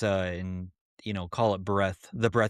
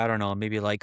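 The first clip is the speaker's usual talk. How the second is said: ordinary delivery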